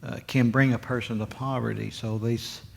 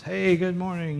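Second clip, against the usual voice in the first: mock cheerful morning hello, pitched higher; cheerful greeting; 6 semitones higher than usual. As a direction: chirp it like a sunny neighbor. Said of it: "greets you cheerfully".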